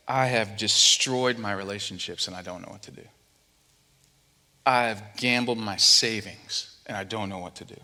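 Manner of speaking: low, subdued admission, pausing between regrets; heavy confession. Acted. Preacher voicing someone who has ruined everything, despairing. Voices people realizing their ruin.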